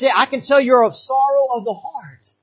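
ordinary talk, nothing marked

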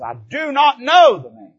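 loud, slow, high angry denial; cursing denial. Retold or imitated. Peter cursing at the girl by the fire, loud furious denial. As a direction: Peter lashes out, spitting each word. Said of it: "he cries out and he curses".

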